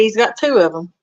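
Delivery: ordinary talk, nothing marked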